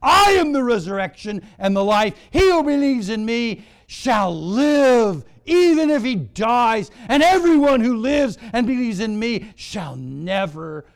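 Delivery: loud, high scripture proclamation; full-force preaching. Real, not acted. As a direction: proclaim the verse to the whole church.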